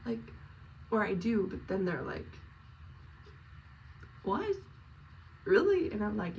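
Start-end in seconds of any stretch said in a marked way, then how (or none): 4.2-5.9 high, loud, incredulous reaction; mock disbelief. Listeners reacting to what she shares, mock disbelief. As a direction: imitate a startled listener, pitch shooting up.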